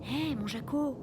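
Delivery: sweet as a flight attendant; suave voice. A narrator's reading. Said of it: "je dis d'une voix suave".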